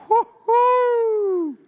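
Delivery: very high, loud drawn-out whoop. Retold or imitated. Preacher reliving the truck rolling up to the lion, whooping. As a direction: let out a long piercing woo-hoo.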